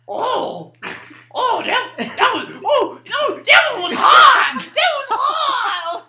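high, loud, drawn-out mimicked wail; imitating a friend howling in disgust; mock agonized yell. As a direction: yell it like the smell burns, hammed-up. Acting out friend reacting to smell.

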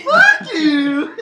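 loud, high comeback; mock-offended outburst; laughing retort. Real, not acted. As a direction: fire back loudly at teasing friends. Answering friends who insult him.

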